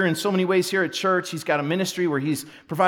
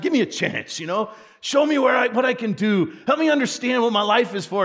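a hint of a pleading young voice, rising higher; imagined eager student; 6 semitones higher than usual.

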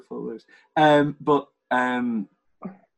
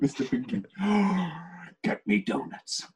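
Mister Pink voice; 6 semitones higher, 6 dB quieter than usual. Retold or imitated.

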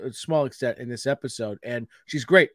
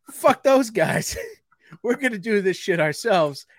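mock defiant brand; 6 semitones higher, 3 dB louder than usual. Fresca voiced brashly dismissing its competitors. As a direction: voice the brand telling rivals off.

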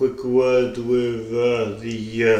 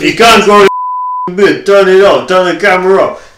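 loud furious yelling; angry shouting; 6 semitones higher, 13 dB louder than usual. Acted. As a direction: bellow it at him. Angry mate yelling demands.

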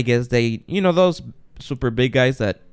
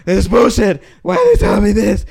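much higher and louder, furious shouting; angry shout; 8 semitones higher, 7 dB louder than usual. Retold or imitated. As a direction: yell it, fed up with the airline. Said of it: "So I get pissed".

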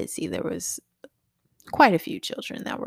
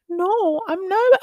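mock whiny child; 14 semitones higher, 7 dB louder, much slower than usual. Mother voices crying daughter.

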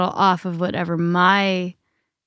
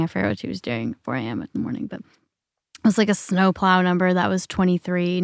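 normal talking voice

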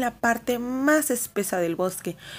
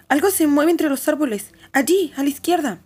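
alarmed; 7 semitones higher, 5 dB louder than usual. A narrator's reading. Child spots something in trees.

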